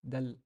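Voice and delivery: "dal" closing the demo with an open a; Arabic dal. Shown as a demonstration. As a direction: land a clean "dal.". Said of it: "instead of".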